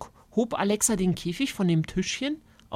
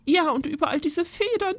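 sighing aunt; 10 semitones higher than usual. Acted. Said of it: "Tante Irmtraut seufzte".

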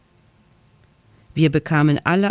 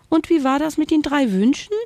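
much higher curious little bat; squeaky bat; 10 semitones higher than usual. Acted. Bat Frieda asks the ghost about the wishes, squeaky. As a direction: ask it high and nosy.

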